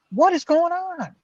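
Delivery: high, loud, drawn-out cry of disbelief; exasperated cry. Retold or imitated. Jobless man pleading with the universe, high exasperated cry. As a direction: throw it up at the sky, fed up. Exasperated after endless rejections.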